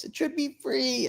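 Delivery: very high, slow, soft mock voice; put-on narrated voice. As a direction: stretch it out in a high character voice. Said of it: "narrating it in that voice".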